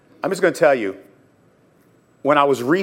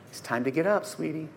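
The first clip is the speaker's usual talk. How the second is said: very soft, tender near-hush; gentle waking; 12 dB quieter than usual. Acted. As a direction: wake a sleeping child softly. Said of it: "waking up a child on a nice, calm morning".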